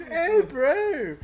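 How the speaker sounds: high, loud hammed-up Australian "Hey, bro"; mock Australian accent. Friend mocking a Love Island Australian, in a hammed-up Australian accent. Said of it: "I don't wanna be anywhere near a fucking Australian accent".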